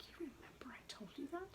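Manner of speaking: high, fast, hushed amazement; whispered disbelief. Retold or imitated. Imitating the doctor surprised she listened: fast high whisper. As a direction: breathe it out, stunned. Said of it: "He would just be like".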